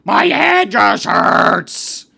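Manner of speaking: loud, drawn-out mock groan; whining complaint. Classmate telling teacher her head hurts, hammed-up whining. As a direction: groan it out slowly, exaggerated and loud. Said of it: "And she's like".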